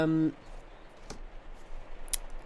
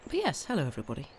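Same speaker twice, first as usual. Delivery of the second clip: ordinary delivery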